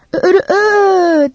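high, slow drawn-out rooster crow; mock rooster crow. A narrator's reading. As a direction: crow like a rooster to amuse the child. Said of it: "diye bağırdı". (horoz gibi yüksek ve uzatarak ötme)

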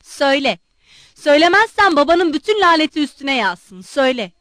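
loud furious commands; father roaring at his son to speak. A narrator's reading. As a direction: roar the order at him. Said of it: "kükremeye devam ediyordu".

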